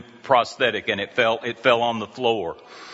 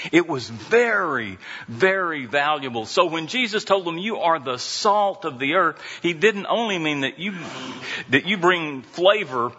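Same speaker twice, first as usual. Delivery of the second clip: raised, emphatic sermon delivery; preaching; 6 semitones higher than usual. Acted. Pastor preaches to congregation about salt, raised and emphatic. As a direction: lift it and preach the worth of salt.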